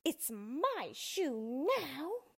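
high, very slow witch taunt. Acted. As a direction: stretch it out to taunt.

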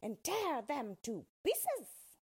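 slow witch's order to her pack; witch crying out. Acted. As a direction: command the wolves like a wicked witch. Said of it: "cried the witch".

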